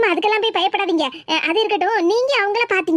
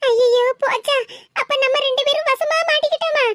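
panicked; 6 semitones higher than usual. Acted. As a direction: panic that they are caught. Fear of being caught.

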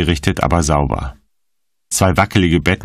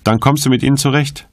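higher, light quoted voice; joking; 8 semitones higher, faster than usual. A narrator's reading. Woman quotes her carefree friend's advice, joking and higher. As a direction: toss it off lightly in a raised pitch. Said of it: "Sie hat gelacht und gescherzt".

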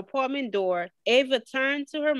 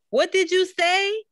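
raised voice; 9 semitones higher, 4 dB louder than usual. A narrator's reading. Can't hear over park noise.

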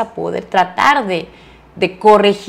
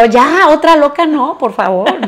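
exasperated plea; 7 semitones higher than usual. Retold or imitated. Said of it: "Y yo ya digo".

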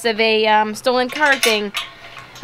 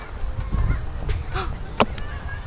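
sharp gasp; 6 semitones higher, 8 dB quieter than usual. Real, not acted. Finds the next clue.

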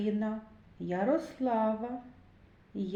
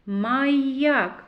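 Маяк with the middle Й held, louder; stress on Й; 3 semitones higher, 5 dB louder than usual. Shown as a demonstration. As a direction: lean on the glide in МАЙ-ЯК. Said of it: "й".

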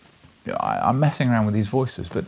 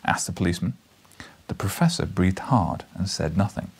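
usual speaking voice

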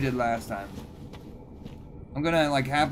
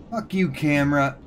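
usual speaking voice

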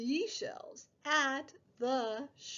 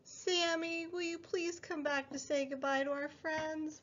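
plain reading voice

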